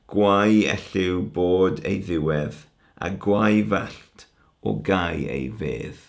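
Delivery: slow, measured Welsh verse reading; poem recitation. Shown as a demonstration. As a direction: recite the englyn slowly, drawing out the vowels. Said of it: "I'm just gonna read you one englyn".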